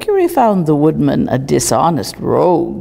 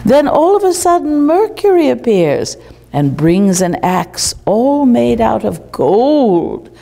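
fable reading with wonder; 7 semitones higher than usual. Acted. Reads the fable aloud.

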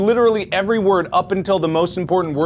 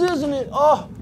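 ordinary talk, nothing marked

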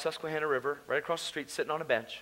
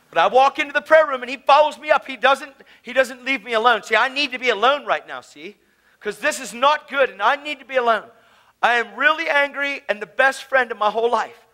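loud, raised, agitated testimony; heated preaching; 8 semitones higher, 8 dB louder than usual. Real, not acted. Preacher reliving wanting to be left alone, heated preaching. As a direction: tell it worked up and insistent.

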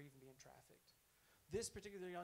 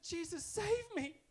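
higher, louder, slow cry for rescue; 14 semitones higher, 11 dB louder, slower than usual. Retold or imitated. Girl attacked at night, crying out for Jesus to save her. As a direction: call out the name desperately.

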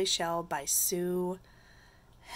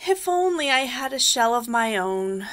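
mildly wistful storybook slug; a touch of yearning; wistful sigh; 4 semitones higher, 10 dB louder than usual. A narrator's reading. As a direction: sigh it out longingly, voice sinking. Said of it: "he sighed".

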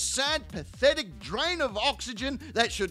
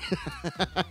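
low amused laugh; chuckle; 5 semitones lower, 4 dB quieter than usual. Real, not acted. Comedian amused at thought of Terry, low chuckle. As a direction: laugh to yourself.

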